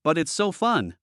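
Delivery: lightly voiced toddler girl; a hint of a little girl's baby talk. A narrator's reading. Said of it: "said in a baby voice".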